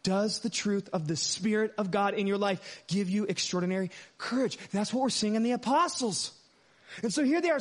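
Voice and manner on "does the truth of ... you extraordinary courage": ordinary talk, nothing marked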